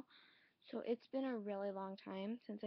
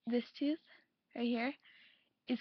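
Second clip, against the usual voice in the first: lip pulled aside; showing teeth while talking; finger at mouth corner; 5 dB louder than usual. Real, not acted. Seen 0: Finger at mouth corner pulls lower lip to show tooth, until 1.1s.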